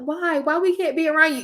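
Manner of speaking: a hint of kids protesting, voice lifted; pleading kids. Little cousins asking to stay near her, lightly mimicked pleading.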